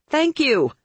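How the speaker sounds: high, exasperated yell into the phone. A narrator's reading. Caller finally drowning out the effusive vamp, yelling. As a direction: yell it to end the gushing. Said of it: "I finally yelled".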